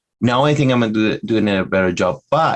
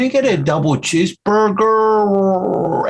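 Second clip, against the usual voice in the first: high, slow, over-rolled R on "cheeseburger"; imitating the wife ordering at the drive-through; exaggerated R; 11 semitones higher, slower than usual. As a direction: mimic the wife's perfect R, much higher. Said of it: "I'm exaggerating the R".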